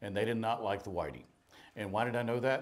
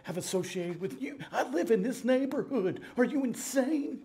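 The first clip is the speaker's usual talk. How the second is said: much higher, alarmed plea with a nervous laugh; panicked colleague; 9 semitones higher than usual. Retold or imitated. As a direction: imitate a scared friend begging him to stop. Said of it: "He goes".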